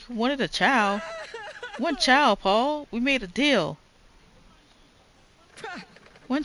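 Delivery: high, indignant scolding; outraged old wife. Acted. Wife berating Paul over the twins, high and indignant. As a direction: demand how he dares, like an offended matriarch.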